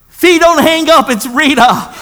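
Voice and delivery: high, loud blurt; imitated urgent plea. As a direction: burst out before he can hang up. Voices wife pleading on phone.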